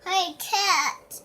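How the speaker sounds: said loud for mom to hear. Real, not acted. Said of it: "I can't hear you".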